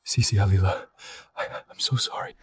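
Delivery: faint, broken words of a dying man; fading breath, barely able to speak; dying weakly. Acted. Stabbed, blood pouring from mouth.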